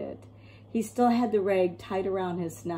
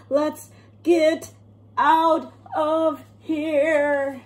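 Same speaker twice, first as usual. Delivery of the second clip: louder, higher choking yell; desperate shout; 8 semitones higher, 7 dB louder, much slower than usual. Acted. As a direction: yell it while gagging on the stink. Burglars choking on gas.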